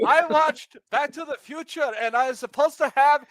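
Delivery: high, loud, laughing mock tirade; a high, shouted childish outrage; hammed-up Greta rant shouting and laughing. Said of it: "the Swedish girl says".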